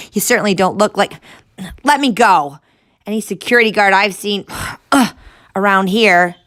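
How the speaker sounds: fast, loud, high, out of breath; strained while struggling loose; panting. Acted. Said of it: "Noah panted as he struggled".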